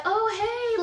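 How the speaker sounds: high-pitched, bubbly imitated greeting; bubbly and sweet. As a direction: imitate their sweet, sing-out hello. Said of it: "like, really bubbly, really sweet".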